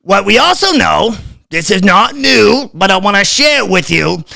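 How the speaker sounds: lampoon the health official's patient lecture; Fauci impersonation. Retold or imitated. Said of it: "I just had to do the Fauci impersonation".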